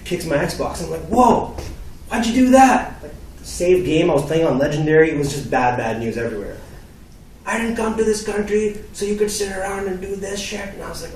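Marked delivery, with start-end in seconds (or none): 1.1-2.9 high, loud mock outrage; retold teenage yelp of protest; outraged protest. Acted son protesting kicked Xbox. 7.4-10.7 put-on high-pitched accented dad scolding; imitating an indignant immigrant father; angry father. Acted father berating son.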